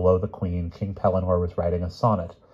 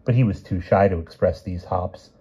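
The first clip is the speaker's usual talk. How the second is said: ordinary delivery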